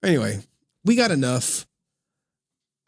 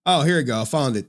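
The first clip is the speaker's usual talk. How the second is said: ordinary delivery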